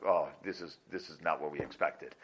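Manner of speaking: soft, stunned murmur of dismay; hushed dismay. Retold or imitated. Dancers caught by Moshe, hushed stunned dismay. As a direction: let it fall away, caught out and quiet. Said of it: "everybody was like".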